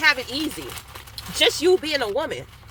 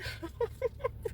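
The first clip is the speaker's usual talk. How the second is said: high breathy laugh; 11 semitones higher, 14 dB quieter than usual. Real, not acted. Laugh after story.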